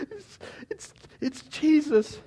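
high, gasping, halting delivery; breathless excitement. Acted. Peter scrambling out of the sea to Jesus, breathless. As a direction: gasp it out as if just swum ashore.

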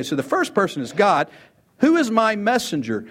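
ordinary talk

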